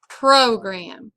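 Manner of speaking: "program" with a stronger PRO; stress on first syllable. Shown as a demonstration. Teacher explaining accent to students, "program" stressed on PRO. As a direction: push PRO in "program" and ease off gram. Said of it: "we say it a little bit stronger".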